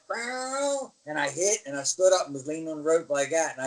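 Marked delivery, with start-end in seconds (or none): 0.1-0.9 high, mock slam sound; a sharp vocal bang; impact noise. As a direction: imitate the hit. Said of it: "So I went".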